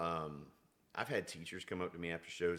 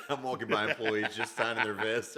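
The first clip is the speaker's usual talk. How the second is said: talking through laughter, louder; laughing; 4 semitones higher, 11 dB louder than usual. Real, not acted. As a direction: chuckle through the memory. Laughing at own story.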